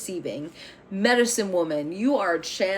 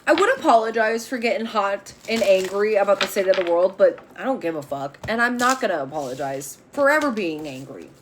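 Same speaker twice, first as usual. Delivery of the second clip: usual speaking voice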